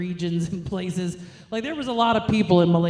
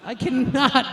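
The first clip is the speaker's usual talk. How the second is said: normal talking voice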